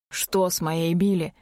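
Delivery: ordinary delivery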